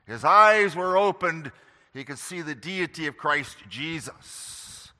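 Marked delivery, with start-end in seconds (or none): none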